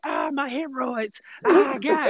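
high fake groaning complaint; hammed-up prank caller in pain; mock pain. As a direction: whine high like faking pain. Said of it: "He was just like".

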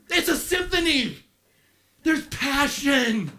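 high, loud, impassioned preaching; fiery sermon climax, voice lifted high; preaching at full force. Acted. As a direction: build it up and pour out the passion. Preaching climax on the Father's love.

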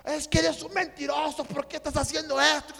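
high-pitched heated accusation; imitated angry brother. Brother calls to accuse him of lying, acted anger. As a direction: re-enact an enraged phone accusation. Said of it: "pero súper enojado".

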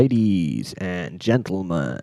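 slow, drawn-out American emcee voice; American accent announcer. Pretend. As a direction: stretch each word, American showman style. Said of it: "doing my American accent for the start".

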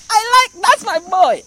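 proud excited God. Pretend. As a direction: shout it like a father who can't hold it in. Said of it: "He tears the heaven and said".

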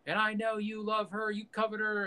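high-pitched mock movie dialogue; acted accusation. Imagined argument over the woman he loves, high acted accusation. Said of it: "He's like".

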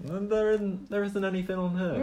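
high, baffled guard voice; high puzzled guard. Acted. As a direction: sound confused, pitched well up. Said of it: "he looks down at his sword and he goes".

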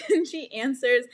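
normal talking voice

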